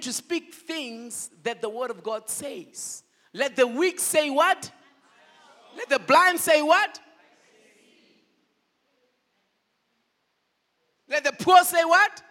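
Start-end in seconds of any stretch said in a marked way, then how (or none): 3.3-12.2 loud rising preacher call; call and response. Real, not acted. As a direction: shout it for the crowd to answer.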